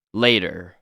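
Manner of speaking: usual speaking voice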